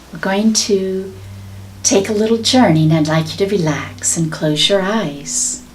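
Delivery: calm, guiding meditation voice; guided meditation. Acted. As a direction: lead the listener into a journey. Starts guided journey.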